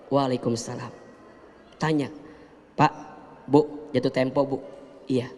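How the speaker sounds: quietly reassuring wife; calm and gentle. Retold or imitated. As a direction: soothe the debt collector calmly. Calm wife hosting debt collector.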